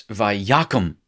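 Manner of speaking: Vayakum with stress on YA, not on the end; accent moved forward. Shown as a demonstration. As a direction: say Vayakum as va-YA-kum. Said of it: "get the accenting right".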